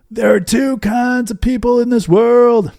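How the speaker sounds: high, loud stage announcer voice; rally the cheering conference crowd with a big opener; booming keynote. Retold or imitated. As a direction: belt it out like a hyped keynote speaker. Speaker's big keynote opening line.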